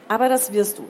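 loud, firm parental order; insistent father. Acted. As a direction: lay down the law to a teenager. Father insists in acted quarrel.

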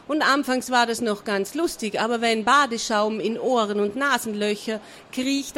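ordinary delivery

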